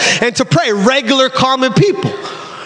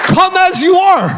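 exhorting; 7 semitones higher than usual. Real, not acted. Preacher exhorts congregation after climax.

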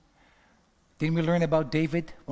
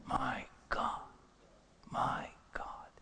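awed whisper; 2 semitones lower, 11 dB quieter, much slower than usual. Real, not acted. Preacher awed aside.

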